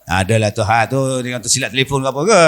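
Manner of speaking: low, quick brush-off; evasive husband. Acted. Husband brushing off his suspicious wife, low and quick. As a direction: mumble the excuse low and fast.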